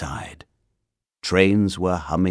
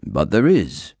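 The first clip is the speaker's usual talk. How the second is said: firm and louder insistence; insisting; 5 dB louder than usual. A narrator's reading. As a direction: plant your feet and insist. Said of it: "he insisted".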